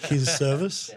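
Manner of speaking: ordinary delivery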